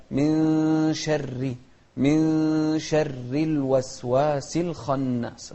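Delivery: slow recited "من شر" with light ra and long hummed nun; thin ra. Shown as a demonstration. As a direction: chant "من شر" with a thin ra. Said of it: "مرققة، فنقول". (ترتيل "من شر" بترقيق الراء)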